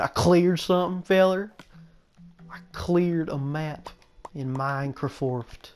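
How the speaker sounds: playful southern drawl on a slow boast; southern accent. Pretend. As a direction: put on a folksy southern accent. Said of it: "I have to say it with a southern accent".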